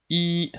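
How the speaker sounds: E held high and level; first tone. Shown as a demonstration. Teacher shows learners the first tone, steady high E. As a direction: say E on a steady high pitch, no change. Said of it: "that's the first tone".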